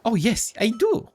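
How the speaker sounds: lightly voiced crab, high; squeaky crab voice. Crab offering information, lightly squeaky voice. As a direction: answer as a high little crab. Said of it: "a squeaky voice".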